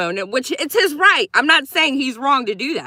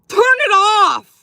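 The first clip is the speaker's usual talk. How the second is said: emphatic command; 10 semitones higher, 7 dB louder, slower than usual. Real, not acted. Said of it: "repeated command to him".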